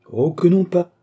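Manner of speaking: high, lifted denial; coquettish protest. A narrator's reading. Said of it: "dit la coquette".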